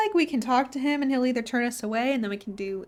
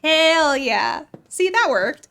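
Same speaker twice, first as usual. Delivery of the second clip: excited; 4 semitones higher, 6 dB louder, much slower than usual. Real, not acted. Celebrating getting in.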